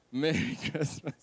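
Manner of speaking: chuckling through the greeting; laughing greeting. Real, not acted. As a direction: laugh breaking into "Merry Christmas".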